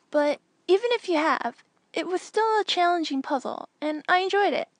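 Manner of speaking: put-on a proud kid who loved the puzzle; young Anakin pleased.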